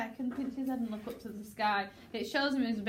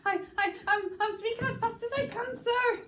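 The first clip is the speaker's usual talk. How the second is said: loud high stuttered outburst; stuttering; 12 semitones higher, 4 dB louder than usual. Shown as a demonstration. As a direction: stammer the start, then burst out high. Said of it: "trying to stutter out".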